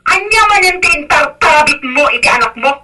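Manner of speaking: high-pitched indignant demand; heated accusing question; angry confrontation. Acted. Angry in-law confronts parent.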